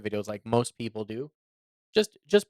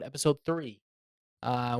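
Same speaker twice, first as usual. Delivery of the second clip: ordinary delivery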